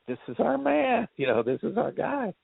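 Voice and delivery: retold delight, pitched high at first; excited discovery. Studio people seeing Tom Hardy's performance, retold excitedly. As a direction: imitate executives thrilled with their find.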